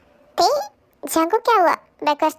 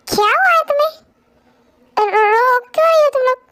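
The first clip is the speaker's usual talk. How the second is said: alarmed; 7 semitones higher, 4 dB louder than usual. Acted. Friend alarmed seeing them cry.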